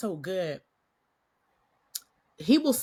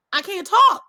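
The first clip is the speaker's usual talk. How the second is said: frantic cry; 9 semitones higher, 10 dB louder than usual. Retold or imitated. Lost voice before conference.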